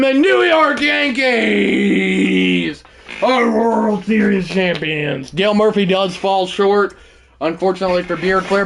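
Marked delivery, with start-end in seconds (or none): 0.0-5.3 championship yell. Pretend. Streamer calling the title again, shouting. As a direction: stretch every word like the big call.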